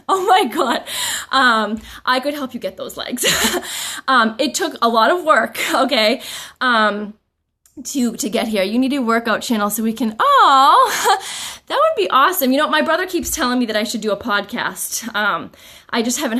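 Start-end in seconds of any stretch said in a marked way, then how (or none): 0.1-0.8 surprised. Real, not acted. Touched by viewer compliment. 10.2-12.5 high, loud burst of delight; light up at the idea; excited. Real, not acted. Excited by viewer suggestion.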